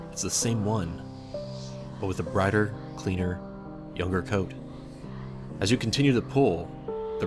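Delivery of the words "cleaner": ordinary delivery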